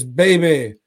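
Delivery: loud, high eager suitor; mock proposal call. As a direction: call out to a sweetheart, hammed up. Voicing old man's proposal in retelling.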